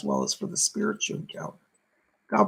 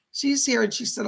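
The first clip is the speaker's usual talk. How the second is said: ordinary delivery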